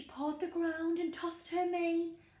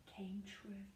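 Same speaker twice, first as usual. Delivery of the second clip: whispered softly as the wish comes true; 6 semitones lower, 12 dB quieter than usual. A narrator's reading. Narrator to children, whispering the magic ending. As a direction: breathe the words like magic. Storybook reading, whispered end.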